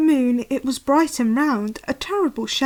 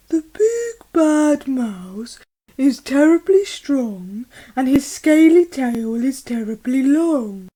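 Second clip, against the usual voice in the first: dramatic Gruffalo dad; 6 dB louder than usual. Acted. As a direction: make the mouse sound terrifying. Gruffalo describes the mouse.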